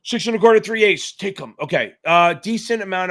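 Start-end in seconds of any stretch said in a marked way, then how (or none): 0.0-1.5 loud, higher bid calling on the trading floor; a hammed-up pit trader barking prices; mock trader call. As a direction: shout the bids like a floor trader. Calling bids again.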